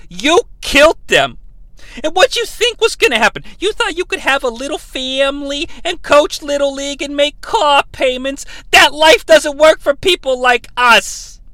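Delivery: Harley Quinn scolding El Diablo, indignant. Retold or imitated. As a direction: lecture him like an offended Harley. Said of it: "she gets really indignant".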